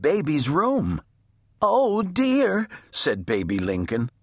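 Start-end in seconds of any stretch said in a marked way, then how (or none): 1.6-2.7 high, worried little voice; fretting little sister. A narrator's reading. Baby frets as her sister runs out, high and worried. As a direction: fret in a high voice.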